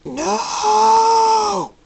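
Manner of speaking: long, very high, loud roar of no. Acted. Storm roaring in protest, drawn-out roar. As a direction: roar the word out at length. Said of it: "The storm roars".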